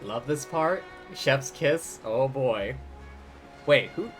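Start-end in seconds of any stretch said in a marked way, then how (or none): none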